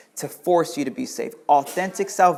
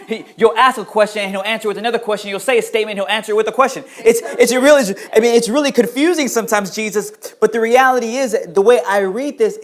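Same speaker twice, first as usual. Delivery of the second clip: fast, laughing, louder burst; excited preaching; 6 semitones higher, 8 dB louder, faster than usual. Real, not acted. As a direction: rush it, amused at the confusion.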